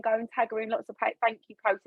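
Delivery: ordinary talk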